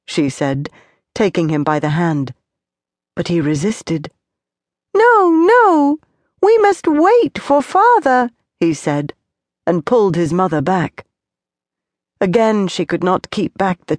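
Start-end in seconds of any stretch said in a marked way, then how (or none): 4.9-8.3 put-on high, loud insistent child; pleading child. A narrator's reading. Tonelli refusing to leave, high pleading child. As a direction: dig in like a small boy refusing. Child resisting his mother.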